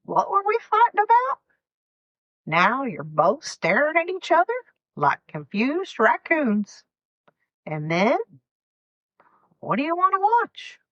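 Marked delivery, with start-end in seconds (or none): 0.0-1.4 high, fast baffled blurt; half-mocking bafflement, much higher; sudden confusion. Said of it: "and suddenly". 9.6-10.8 breezy question. Retold or imitated. Couple moving on after argument.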